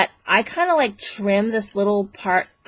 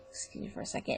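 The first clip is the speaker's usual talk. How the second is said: a quick unvoiced whisper, turned away; whispered aside; 17 dB quieter, much faster than usual. Real, not acted. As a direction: whisper an apology off-mic. Aside off to the side.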